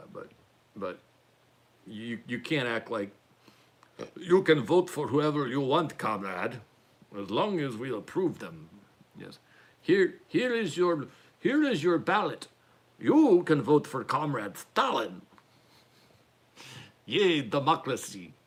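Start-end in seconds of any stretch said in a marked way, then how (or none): 4.2-15.2 hammed-up Russian accent, drawn-out words; terrible Russian accent. Comedian mocking rigged ballots as a Soviet official, bad Russian accent. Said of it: "terrible Russian accent". 17.1-18.3 slow mock cheer in a bad Russian accent; terrible Russian accent. Comedian cheering sham democracy, slow bad Russian accent. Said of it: "terrible Russian accent".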